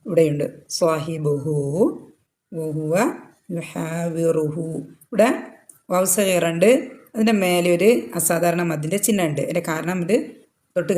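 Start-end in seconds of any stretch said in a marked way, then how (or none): 3.5-4.7 യുഹാവിറുഹു slow, final ഹു vowel held two counts; stretched vowel. Shown as a demonstration. Teacher reciting to show the two-count madd. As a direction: draw the last hu out gently. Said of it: "രണ്ട് count നീട്ടിയിട്ട് pronounce ചെയ്യുക".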